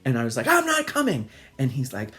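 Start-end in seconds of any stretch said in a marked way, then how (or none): 0.5-1.3 put-on high, loud little-kid refusal; mock defiant child. Child refusing mother through bathroom door, mock defiant. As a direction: play a kid digging in his heels.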